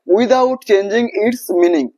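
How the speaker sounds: slow, deliberate stress on without changing its meaning; teacher hammering home the rule; slow emphasis. Shown as a demonstration. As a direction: spell out the rule word by word. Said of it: "teacher stresses key rule".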